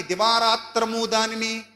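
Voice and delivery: slow, solemn Bible quote; stately, unhurried intoning of a verse; scripture recitation. Acted. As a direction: draw the verse out reverently. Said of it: "అన్నాడు".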